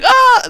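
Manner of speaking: imitated rock-star squawk; rocky yelp. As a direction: repeat the rock singer's shriek. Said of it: "it's kind of a rocky noise".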